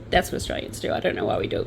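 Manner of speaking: usual speaking voice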